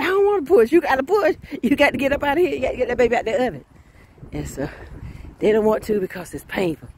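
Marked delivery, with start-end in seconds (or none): 0.0-0.7 high, loud whining protest; whiny mother in labour. Acted. 0.7-3.6 high, insistent urging, fast at the end; urging midwife. Acted. Midwife urging a mother in labour.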